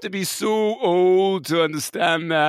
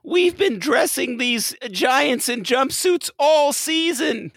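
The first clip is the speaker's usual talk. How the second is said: frantic caller; 7 semitones higher, slower than usual. Pretend.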